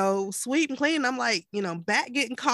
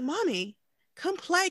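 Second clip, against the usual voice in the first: put-on high little kid with a British lilt; British-accented child; 8 semitones higher than usual. Mom mimics her son asking to play, British child voice. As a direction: imitate a small child calling in British. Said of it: "he sounds British when he talks".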